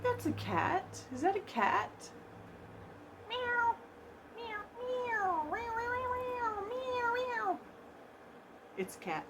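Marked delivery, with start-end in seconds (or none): 3.3-7.6 very high, soft little kitty mews; cat meowing. Pretend. Unboxer playing with a toy cat, meowing. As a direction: mew repeatedly like a tiny cat.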